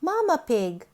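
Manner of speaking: ordinary delivery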